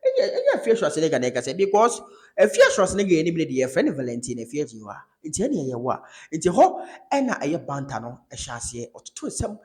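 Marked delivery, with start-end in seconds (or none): 0.1-5.0 laughing retelling. Retold or imitated. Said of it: "Ɛna Bolare sɛ".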